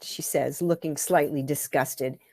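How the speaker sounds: ordinary delivery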